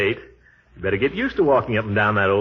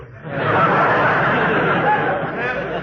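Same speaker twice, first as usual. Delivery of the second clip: laughing; 7 semitones higher than usual. Real, not acted. Gildy's huffy exit laugh.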